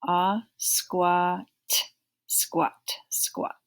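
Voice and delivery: "squat" with an open ah vowel, skwaht. Shown as a demonstration. Teacher pointing out the ah vowel, "squat" as skwaht. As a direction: lean on the ah in "squat". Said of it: "this A sounds like an ah also".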